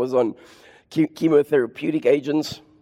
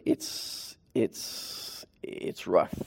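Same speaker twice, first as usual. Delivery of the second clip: hushed doctor; 7 semitones lower, 12 dB quieter, much slower than usual. Retold or imitated.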